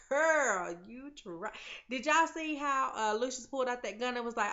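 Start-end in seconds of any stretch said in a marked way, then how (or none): 0.1-1.5 put-on a sassy "Girl" thrown out, then dropped. To the TV character, a sassy mock tease. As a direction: tell her off with sass. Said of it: "And I was like".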